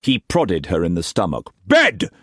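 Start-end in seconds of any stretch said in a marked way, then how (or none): 1.7-2.1 high, loud one-word command; harsh order. A narrator's reading. Struan sending the servants to bed, harsh order. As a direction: bark it harshly. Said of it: "he ordered harshly".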